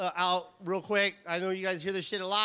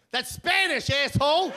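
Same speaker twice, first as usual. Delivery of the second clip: higher, louder mock yell; yelled retort; 5 semitones higher, 4 dB louder than usual. Cop calling after the comic who walked off, indignant mock yell. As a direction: holler it after someone, imitating the cop. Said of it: "this is what I hear".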